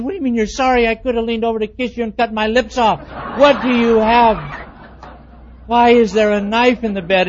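loud, indignant questioning; demanding. Retold or imitated. Husband loudly demands why the knife is in bed. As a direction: press her for an answer.

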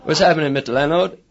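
lightly voiced cheeky wife; casual wife voice. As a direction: toss it off like a hip modern wife. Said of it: "she says".

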